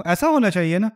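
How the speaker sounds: normal talking voice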